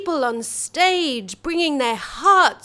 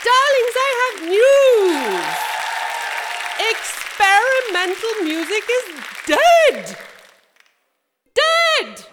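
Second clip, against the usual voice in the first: campy drag queen; 3 semitones higher than usual. Acted. Campy host Monsterina addressing audience.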